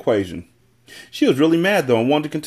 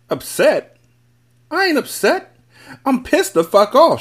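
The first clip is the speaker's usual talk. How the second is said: high-pitched, indignant outburst; bristle, then slow down on the furious punch; angry yell; 13 semitones higher, 3 dB louder, slower than usual. A narrator's reading. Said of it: "she yelled".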